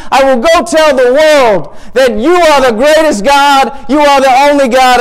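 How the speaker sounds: high, loud, overjoyed declaration; exultant praise. Acted. Asaph thanking God for showing him the truth, exultant. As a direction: pour it out as jubilant testimony.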